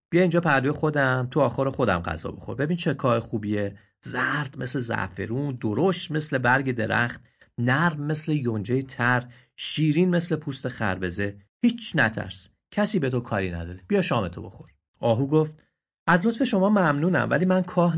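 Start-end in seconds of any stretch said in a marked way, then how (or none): 0.1-1.3 kind invitation. Acted. Noble donkey invites the deer. 1.3-14.7 kindly donkey coaxing. Acted. Noble donkey praises the straw.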